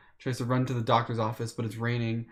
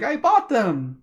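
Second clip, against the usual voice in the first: put-on higher, louder eager kid; little brother voice; 9 semitones higher, 6 dB louder, slower than usual. Little brother insisting to his big brother. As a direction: blurt it out proudly like a little kid.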